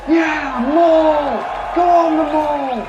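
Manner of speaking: cheering crowd. Acted. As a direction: cheer for the fighter like a ringside crowd. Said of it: "the crowd kind of watching are like".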